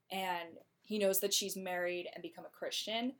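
ordinary talk

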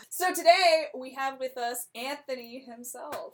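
high, loud opening that settles; show host introduction. Pretend. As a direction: announce a star guest. Host introduces pretend interview guest.

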